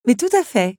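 high, loud mock agreement; teasingly over-convinced; ironic agreement. A narrator's reading. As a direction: agree with exaggerated irony. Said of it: "ironisa Belle".

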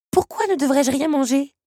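high, loud demanding question; insistent protest; challenging. A narrator's reading. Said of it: "le relança Belle".

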